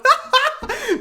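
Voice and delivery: sudden squeaky laugh; giggling fit; high burst of laughter. Real, not acted. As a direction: crack up at the absurd offer. Laughing at his own trade.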